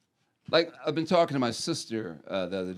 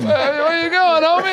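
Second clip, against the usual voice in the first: higher and louder, laughing through the words; laughing call-out; 14 semitones higher, 8 dB louder than usual. Real, not acted. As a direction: shout it mid-laugh at your buddy. Laughing banter at the table.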